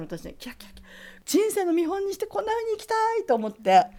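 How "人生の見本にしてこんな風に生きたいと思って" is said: much higher and louder, swept up in awe; awed. Real, not acted. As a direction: gush about her like she's a goddess. Awed at the goddess-like woman.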